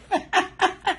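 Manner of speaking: giggling after scolding the reader; real laugh. Real, not acted. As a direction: giggle at your own line. Laughing at own joke.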